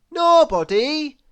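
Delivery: high, loud disbelieving question; astonished gasp. A narrator's reading. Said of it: "gasped Mister Happy".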